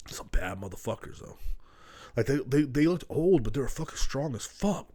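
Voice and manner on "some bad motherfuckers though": hushed unvoiced whisper. Real, not acted. Describing his grandpa's tough friends, in a hushed whisper. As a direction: drop to a whisper, half in awe.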